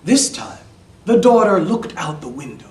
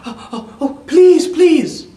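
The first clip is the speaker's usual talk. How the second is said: very high, gasping then loud pleading; acted relief; 14 semitones higher, 10 dB louder than usual. A narrator's reading. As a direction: squeal please in eager relief. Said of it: "the daughter was very relieved".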